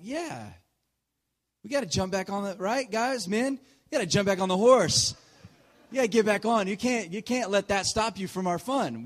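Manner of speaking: rally the guys like a coach; hammed-up enthusiastic urging; rallying pep talk. Retold rallying the family to continue.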